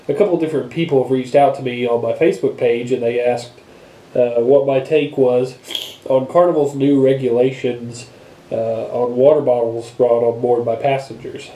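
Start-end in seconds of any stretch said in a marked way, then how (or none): none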